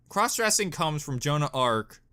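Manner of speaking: ordinary delivery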